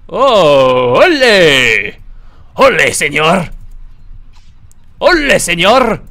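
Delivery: loud, theatrical olé cry; booming put-on Spanish bravado; mock Spanish shout. Plays a Spanish bullfighter character.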